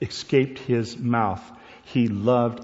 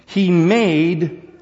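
made punched on its own; stress on made; 6 semitones higher, 6 dB louder than usual. Shown as a demonstration. Preacher repeating made for emphasis. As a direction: hit made alone, firmly. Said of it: "emphasize the word made".

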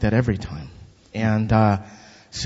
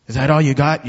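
louder mock taunt, quoting the other man; retold taunt; 3 semitones higher, 8 dB louder than usual. The man on the bike jeering after the slap, a loud mock taunt. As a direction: jeer it back like the man on the bike.

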